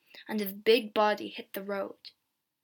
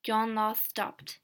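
ordinary delivery

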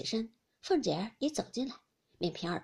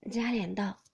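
plain reading voice

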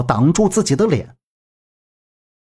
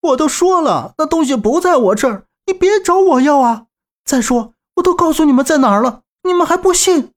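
much higher, indignant yelling; shouting back; 10 semitones higher than usual. Acted. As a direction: fire back at the thugs, pitch way up. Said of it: "左阳探出头喝道". (高声愤怒地喊回去)

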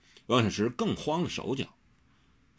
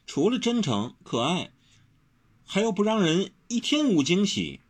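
high, tender sincerity; gentle, higher-voiced earnestness; earnest soft-voiced; 11 semitones higher than usual. A narrator's reading. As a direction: turn serious and sweet, pitched high. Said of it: "温柔忽正色柔声地道".

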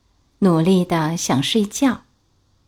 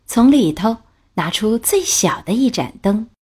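usual speaking voice

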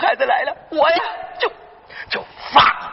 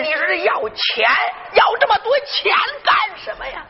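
exasperated outburst; 8 semitones higher than usual. Acted. Old grandfather scolding about money, shouting. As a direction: rant the question at the top of the voice.

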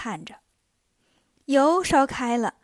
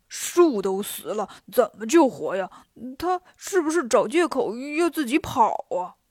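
doubting Bajie. A narrator's reading. Bajie doubting that Wukong can revive the tree, sceptical. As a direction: drag the words out in doubt.

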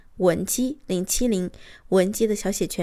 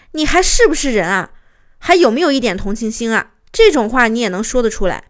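louder, indignant acted comeback; retold angry retort; 5 dB louder than usual. Wife hitting back at her callous husband, acted indignation. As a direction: fire back as an outraged wife. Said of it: "我就直接反击他".

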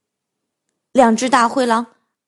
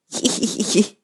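sly whispered giggle; 4 semitones lower, 5 dB quieter than usual. Acted. Wolf giggles to himself.

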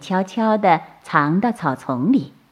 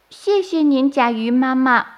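put-on high childlike thanks; baby turtle voice; 6 semitones higher than usual. A narrator's reading. Baby turtles thank softshell mother, high and childlike. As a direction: say thanks in a tiny hatchling voice.

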